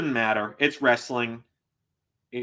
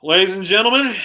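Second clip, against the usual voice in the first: mock announcer; 6 semitones higher, 10 dB louder than usual. Toasting the shot to chat.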